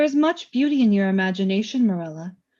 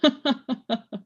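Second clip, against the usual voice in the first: amused laugh; 3 semitones higher than usual. Acted. Death amused by Morella's answer.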